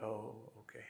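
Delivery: soft, crestfallen okay; deflated acceptance. Retold or imitated. Polly accepting his guru's ban, soft and crestfallen. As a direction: let it sag in defeat.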